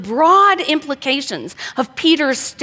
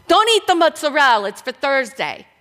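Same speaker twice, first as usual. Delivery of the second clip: Italian mom; 3 semitones higher than usual. Retold or imitated. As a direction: scold the family like an Italian mom. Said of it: "stuff Italian moms say".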